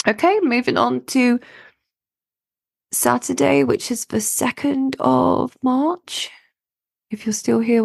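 hoarse, croaky throat; talking through a croaky voice. Real, not acted. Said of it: "croaky voice".

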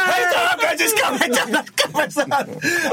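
high, slow, jubilant call to the whole set; excited shout. Retold or imitated. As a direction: announce it like the star has arrived. Director yelling at crew.

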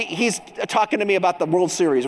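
ordinary talk

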